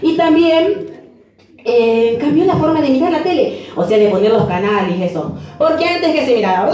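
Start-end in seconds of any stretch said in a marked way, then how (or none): none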